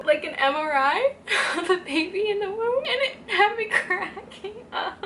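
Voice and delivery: giggling through the words; cracking up while talking; laughing. Real, not acted. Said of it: "I can't stop laughing".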